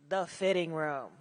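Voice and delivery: low and deliberate, after a long pause; dramatic pause. Shown as a demonstration. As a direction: let it land after a breath. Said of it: "give yourself the dramatic pause".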